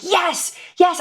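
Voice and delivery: high-pitched thrilled exclamation; so excited. Retold or imitated. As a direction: reenact jubilant agreement. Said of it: "I was so excited".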